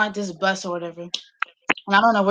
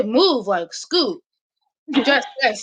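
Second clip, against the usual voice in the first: grandma's Liberian English; 3 semitones higher, slower than usual. Retold or imitated. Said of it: "But she's trying to say".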